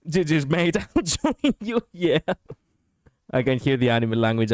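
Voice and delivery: ordinary talk, nothing marked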